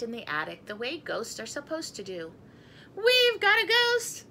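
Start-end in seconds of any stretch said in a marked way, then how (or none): 3.0-4.2 high, loud, slow proud announcement; puffed-up family bragging about their ghost; proud boast. A narrator's reading. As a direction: crow it out proudly. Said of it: "said the Scotts proudly".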